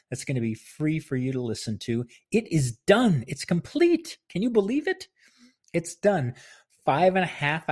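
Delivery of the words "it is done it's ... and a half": high, loud and thrilled; giddy announcement, much higher and louder; excited. Real, not acted. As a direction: burst out with the big news. Announcing audiobook finished, thrilled.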